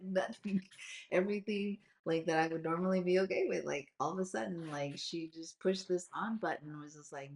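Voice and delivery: ordinary talk, nothing marked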